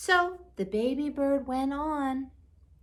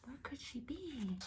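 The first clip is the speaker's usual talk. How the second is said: much softer, hushed echo of the question; whisper; 17 dB quieter than usual. Shown as a demonstration. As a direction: whisper it to the children. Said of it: "repeats the question hushed".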